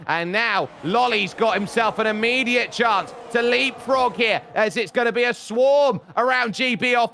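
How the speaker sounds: rising, excited restart call; live race commentary. Real, not acted. Commentator calling the restart jump to viewers, excited play-by-play. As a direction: build in pitch as the green flag drops.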